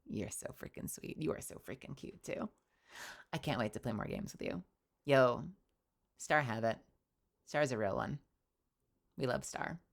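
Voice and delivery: soft chill radio host voice; late night radio voice. Real, not acted. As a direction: wind down like a mellow after-hours DJ. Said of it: "going into, like, chill late night radio".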